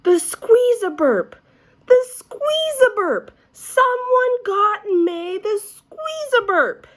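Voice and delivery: put-on very high, loud, slow kids' shriek; shouting. A narrator's reading. Children shouting in delight at May's gift, very high and loud. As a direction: scream it in delight. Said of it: "everybody started shouting".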